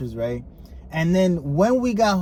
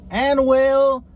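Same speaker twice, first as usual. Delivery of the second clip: drawn-out, high, loud Anglicized name; mock American announcer; 7 semitones higher, 4 dB louder, slower than usual. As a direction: say it slowly in a hammy Southern American accent. Mock announcer reads translated name.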